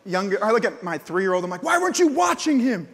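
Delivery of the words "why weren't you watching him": high, loud blaming shout; exasperated scold. Retold or imitated. Dad scolding his three-year-old, high loud blame. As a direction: snap it at the kid.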